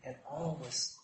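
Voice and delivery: fading to near silence; hushed calm. A narrator's reading. Telling how the storm subsided, hushed and fading. Storm dying away in story.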